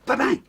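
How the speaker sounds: mock punch noise. Mimicking punches in fight story.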